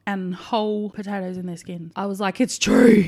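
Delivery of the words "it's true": loud, delighted retold discovery; amazed exclamation. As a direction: exclaim it loudly, as if astonished. Retells delighted astonishment at potatoes.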